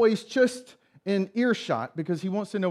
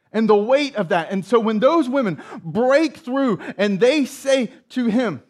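raised, high-pitched, driving sermon voice; impassioned preaching; 5 semitones higher, 6 dB louder than usual. Real, not acted. Preacher describing the weight of Peter's regret, impassioned preaching. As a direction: pile up the phrases with mounting urgency.

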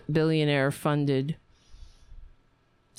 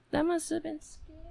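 high, soft, quick pet voice; cooing sympathy; 10 semitones higher, 5 dB quieter, faster than usual. Real, not acted. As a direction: soothe a scared pet in a high coo.

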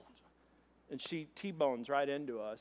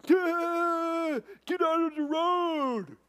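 high, loud, drawn-out holler; drunk heckler yell; 11 semitones higher, 6 dB louder, much slower than usual. Retold or imitated. Drunk passers-by heckle the crash victim, slow loud yell. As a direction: imitate a drunk yelling from a passing car. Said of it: "drunk, like".

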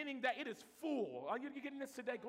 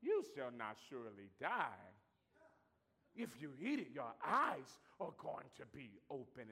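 low, sly tempter voice, much lower; the serpent's voice; 12 semitones lower, 3 dB quieter, slower than usual. Retold or imitated. Preacher voicing the serpent to Eve, low and sly. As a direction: hiss it out like a tempting snake. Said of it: "And he goes".

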